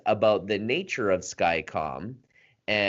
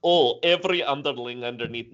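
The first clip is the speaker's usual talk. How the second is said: higher, put-on Russian accent; mock Russian accent; 4 semitones higher, 3 dB louder, faster than usual. Host mimics a denying prince in a mock Russian accent. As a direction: ham up a Russian accent for the quote. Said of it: "now I've got a Russian accent".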